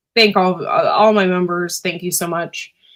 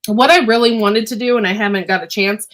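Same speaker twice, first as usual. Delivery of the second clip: ordinary delivery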